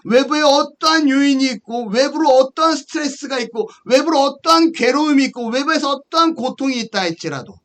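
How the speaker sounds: high, insistent preacher's cadence; emphatic preaching. Acted. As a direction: drive each point home from the pulpit. Preaches with building force.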